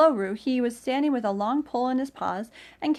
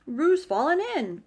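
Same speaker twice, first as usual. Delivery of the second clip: louder alarmed cry; higher, louder alarmed cry; cried out; 6 semitones higher, 6 dB louder than usual. A narrator's reading. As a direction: call out the alarm. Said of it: "cried Rabbit".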